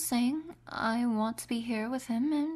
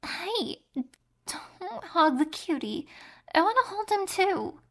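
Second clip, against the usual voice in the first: higher, faster and louder, a whiny protest; jealous whine; 6 semitones higher, 5 dB louder than usual. Acted. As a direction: cut in like a jealous child wanting a turn. Jealous partner interrupts the hug.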